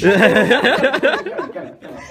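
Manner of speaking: laughing while shushing; trying to calm him through laughter. Real, not acted. Boss calming his laughing colleague, laughing.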